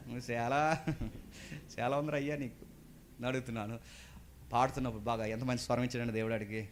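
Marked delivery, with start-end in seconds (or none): none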